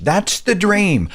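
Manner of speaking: high, slow, starry-eyed mockery; mock grateful dreamer. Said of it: "those being crushed are like".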